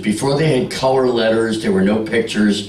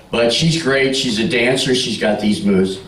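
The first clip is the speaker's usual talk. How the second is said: usual speaking voice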